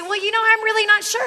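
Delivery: lightly voiced unsure customer; unsure prospect. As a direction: hesitate like a wavering buyer. Salesman voices unsure prospect again.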